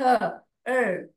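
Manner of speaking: ordinary talk, nothing marked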